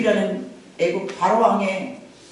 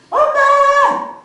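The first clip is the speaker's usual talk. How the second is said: drawn-out high call of mom, much louder; long calling cry; 6 dB louder, much slower than usual. Retold or imitated. Daughter calling her mother, long high loud cry. Child calling grandmother loudly.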